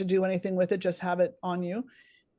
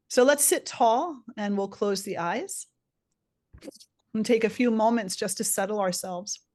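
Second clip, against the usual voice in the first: guided meditation. Acted. Leads a meditation.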